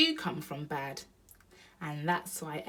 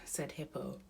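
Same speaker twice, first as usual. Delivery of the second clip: plain reading voice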